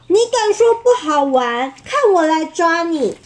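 稍高稍响地吼，孩子吓人; 假装凶猛地喊“看我来抓你”. Acted. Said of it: "阿宝大吼". (阿宝冲小吉大吼，凶巴巴地)

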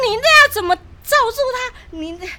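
put-on high scared objection to boyfriend; panicked protest. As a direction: cry out in disbelief and fear.

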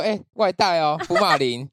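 shouts it across the room, loud and bright. Retold or imitated. Doctor loudly announcing a takeout foreskin, shouted. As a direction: call it out loudly for everyone to hear. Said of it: "然后就大声地嚷说".

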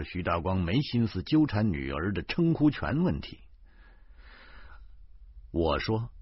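plain reading voice

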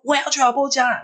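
high-pitched, stubborn outburst; retold fierce insistence. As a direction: act out a cross sleepwalker insisting. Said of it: "他说我很凶".

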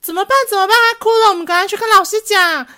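high, alarmed schoolkids fretting and tattling; panicky kid crowd. Retold or imitated. Classmates panic over crying boy, high alarmed kid voices. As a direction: squeal it like worried classmates. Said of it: "然后群众就说".

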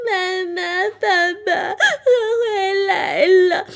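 put-on high, loud, sobbing little-girl voice; crying. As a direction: wail it out through tears, slowly. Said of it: "今天她一边哭一边走回家". (爱哭公主哭着回家喊爸妈)

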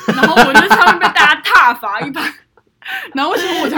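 loud laughing, rushed; laughing and talking fast. Real, not acted. Laughing about own post. (边大笑边快速说)

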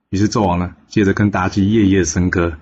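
usual speaking voice

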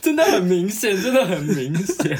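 words broken by laughter; laughing. Real, not acted. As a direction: laugh through the words, slowly. Laughing at the hiccups.

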